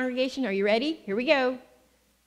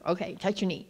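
ordinary delivery

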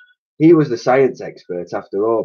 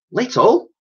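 high, startled squeak of disbelief; exclaiming; 13 semitones higher, 4 dB louder than usual. A narrator's reading. Elsie reacting to the little electric shock, startled. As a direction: shoot the word up in shock. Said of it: "exclaimed Elsie".

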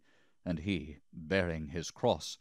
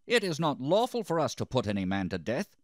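higher, louder objection; raised protest; 7 semitones higher, 5 dB louder, faster than usual. A narrator's reading. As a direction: push back firmly in a raised voice.

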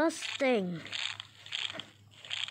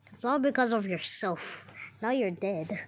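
ordinary delivery

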